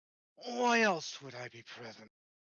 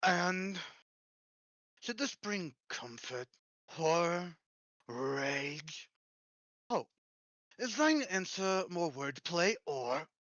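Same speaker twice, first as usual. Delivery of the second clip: breathy voice; 4 semitones higher, 3 dB louder than usual. Acted. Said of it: "doing such a breathy voice".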